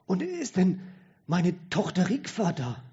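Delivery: frail father calling for his daughter; dying old man. A narrator's reading.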